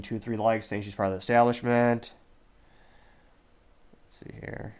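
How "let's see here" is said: voiceless, barely-there mutter of "Let's see here"; whispered to self. Real, not acted. Host scrolling comments, whispering to himself. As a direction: breathe it to yourself while reading. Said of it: "scrolling comments, repeats phrase".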